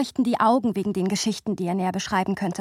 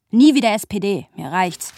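fed-up outburst; 8 dB louder than usual. Retold or imitated. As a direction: slam the door on the party. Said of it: "er lässt sich nicht beruhigen". (lauter, genervter Ausbruch)

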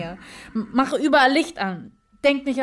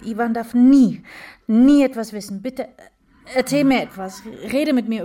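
desperate plea; 6 dB louder than usual. Acted.

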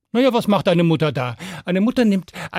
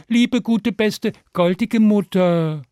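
put-on higher, slower wheedling child; pleading fifth child; 5 semitones higher, slower than usual. A narrator's reading. Fifth child flattering mother for pancake, slow pleading. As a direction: pile on the flattery, drawn out. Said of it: "das fünfte Kind sagte".

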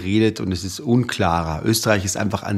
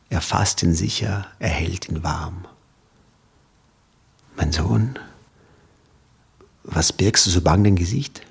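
dramatic poem recital. Shown as a demonstration. Guest reciting his favourite poem on air, dramatic. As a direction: recite the ballad with grave suspense. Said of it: "Jetzt soll ich was aufsagen?".

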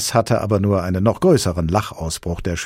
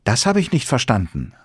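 put-on high, louder little girl scolding; a schoolgirl shaking her head; little girl disapproving; 7 semitones higher, 5 dB louder than usual. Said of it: "sagte das Mädchen".

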